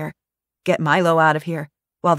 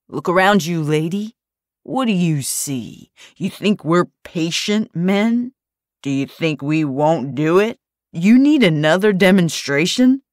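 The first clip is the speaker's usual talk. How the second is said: menacing thug; 5 dB louder than usual. Acted. Thug threatens Daphne.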